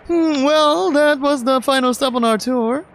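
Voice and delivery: put-on high, upbeat young man; bright excited tour guide boyfriend; lifted pitch, cheerful guy; Mark's voice. Mark voiced again.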